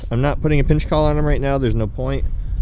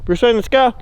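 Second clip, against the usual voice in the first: calling out to dog; 10 semitones higher than usual. Real, not acted.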